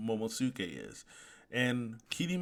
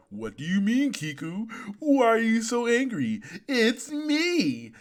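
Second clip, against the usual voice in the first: high, loud, hammed-up protest; feigned innocence; 9 semitones higher, 5 dB louder than usual. Impostor keeping up the act as Kiku charges, hammed-up innocence. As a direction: play the wronged friend, pitch climbing to the end. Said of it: "still keeps up the act".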